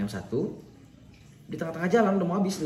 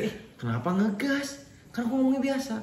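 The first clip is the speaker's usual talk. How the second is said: retold protest; 9 semitones higher than usual. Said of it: "kamu dari tadi ngegas".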